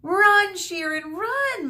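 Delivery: high, loud desperate shout. A narrator's reading. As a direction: scream at him to run. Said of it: "Medusa shouted".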